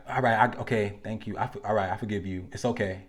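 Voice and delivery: soft, defeated mumble of it's okay; quietly swallowing your feelings; resigned giving in. Retold or imitated. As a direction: sigh it out like you've given up. Mimics partner giving in quietly.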